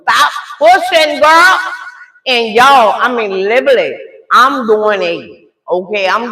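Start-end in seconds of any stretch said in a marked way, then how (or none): 0.0-1.6 loud, high, drawn-out mock order; slow, raised retold command; acted firm holler. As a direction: re-enact a mother yelling stop. Mother ordering daughter, retold.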